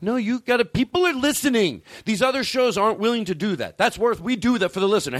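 mock host hype. Host hyping his show to the listener, mock excited. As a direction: sell it like a cocky late night host.

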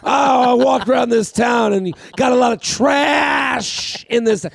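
slow, high taunting promo; wrestling heel shout. Retold or imitated. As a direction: sneer at the town, slowly. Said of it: "He said".

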